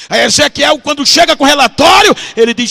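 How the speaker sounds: loud, high narrative surge; fervent preaching. Acted. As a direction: burst into full-force preaching.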